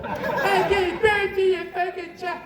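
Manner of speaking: high, loud, slow indignant mock rant; milk the childbirth card with outrage; mocking wife. Mocking women who play the childbirth card, shrill slow rant. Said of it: "that's their ace in the hole".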